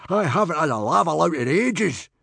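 high, loud whine of appetite; eager yell. A narrator's reading. Warrior wants his turn at the enemy, yelling. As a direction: shout it greedily. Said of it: "yelled Baggy Bum the beer belly".

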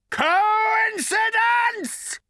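incredulous shout. Acted. Creature shouts in disbelief.

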